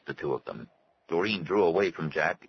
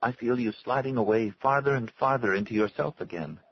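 tearful plea; 6 semitones higher than usual. A narrator's reading. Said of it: "Her eyes full of tears".

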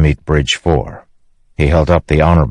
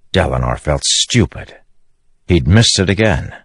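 ordinary delivery, nothing marked

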